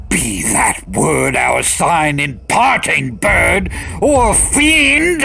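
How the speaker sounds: high, loud shriek of fury; screaming at the fiend. Acted. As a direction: rise to a furious shriek. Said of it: "I shrieked".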